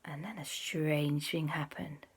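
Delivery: slow, soft, whispery reveal; hushed wonder. A narrator's reading. Storyteller reveals something strange in the sky, hushed wonder. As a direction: let each phrase hang in suspense. Suspense before the rainbow.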